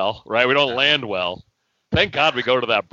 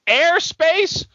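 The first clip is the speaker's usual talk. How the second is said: high, mocking repeat; incredulous again. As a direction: scoff at Airspace once more. Repeated outraged question.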